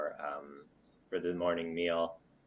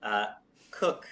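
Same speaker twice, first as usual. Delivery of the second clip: ordinary talk, nothing marked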